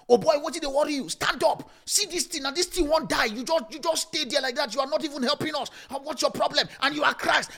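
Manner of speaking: high, loud, fast panicky Nigerian pidgin. Acted. Frantic disciples scolding sleeping Jesus, Nigerian pidgin. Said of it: "How do I get into Nigerian language?".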